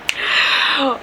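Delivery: long, breath-only gasp; shocked gasp. Retold or imitated. Driver spotting the Mexican flag, horrified gasp. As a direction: suck in air in horror.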